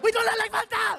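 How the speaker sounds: put-on high, loud German-accented shout; indignant German heckler yelling back; mock-outraged high bellow; angry German. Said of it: "the guy was like".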